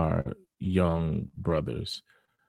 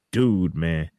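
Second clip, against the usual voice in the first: ordinary delivery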